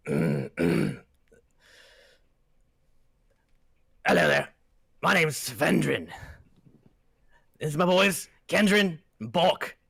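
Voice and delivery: lightly voiced hearty dwarf greeting; dwarf leader introduces his sons to the party. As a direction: greet the strangers as a friendly dwarf. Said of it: "I need to get a better voice for them".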